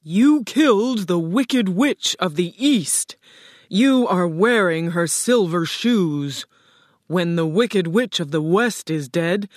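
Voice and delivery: loud, imposing statement; booming Oz. A narrator's reading. Oz credits Dorothy with the killing, booming. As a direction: boom it out with heavy stresses.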